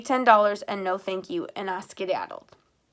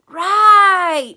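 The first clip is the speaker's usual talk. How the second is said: much higher, much louder burst; sudden realization; 11 semitones higher, 15 dB louder than usual. A narrator's reading. Boy remembers the sandals, excited outburst. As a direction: it just clicked, shout it. Dale smacks his forehead, realizing.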